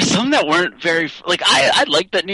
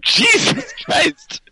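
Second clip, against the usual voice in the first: laughing; much slower than usual. Real, not acted. Friend sprays drink laughing.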